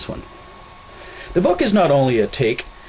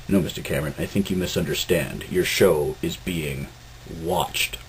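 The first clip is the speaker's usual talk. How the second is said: low quiet menacing officer; ominous low voice; 5 semitones lower, 5 dB quieter than usual. Acted. Captain ominous correction.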